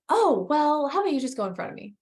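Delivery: lightly voiced gentleman making an offer; kind older man offering. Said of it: "He's like".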